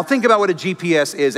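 normal talking voice

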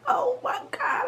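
high whispered Oh my God, imitating mom; mom's whispered gasp. Retelling mom hearing the baby news, high whisper. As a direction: breathe it out high like a stunned mother. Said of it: "my mom was like".

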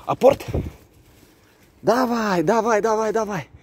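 high, bright praise for the dog; talking to a dog. Real, not acted. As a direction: cheer the dog on, pitch up. Excited fetch cue to dog.

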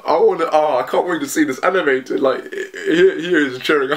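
can't contain the excitement; excited. Real, not acted. Fan thrilled about seeing the scene animated, excited.

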